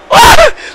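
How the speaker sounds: a sudden very high, loud burst of laughter; shrieking laugh. Real, not acted. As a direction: crack up loudly at the fan. Laughing at the fan glitch.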